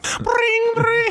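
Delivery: very high trilled harp noise; high mock harp ringing; harp impression. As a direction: trill high to end the daydream. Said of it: "impression of two terrible instruments".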